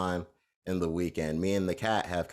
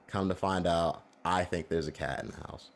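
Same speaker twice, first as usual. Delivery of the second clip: ordinary talk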